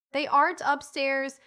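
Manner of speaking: mock scream of scorn, not full force; scornful scream. A narrator's reading. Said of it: "screamed Van in scorn".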